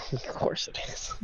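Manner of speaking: breathless amused whisper. Real, not acted. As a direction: whisper it under a laugh. Laughing aside at giant sword.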